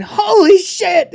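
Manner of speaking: very high, loud, slow mock shriek; mock scream. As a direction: yelp it out like into a pillow. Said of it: "into a pillow".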